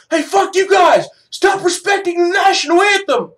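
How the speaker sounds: high, loud yelled taunt; mock heckler shout. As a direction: holler it like an angry heckler. Mimics heckling the kneelers.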